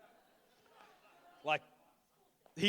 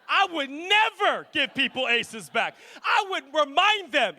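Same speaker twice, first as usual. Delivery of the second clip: loud, high mock outrage; indignant outburst; 10 semitones higher, 12 dB louder, slower than usual. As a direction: shout it in comic disbelief. Acted indignation at giving aces back.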